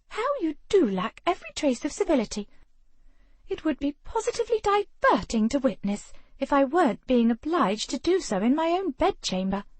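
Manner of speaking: a hint of a snobbish aristocrat; haughty lady. A narrator's reading. Haughty Penelope.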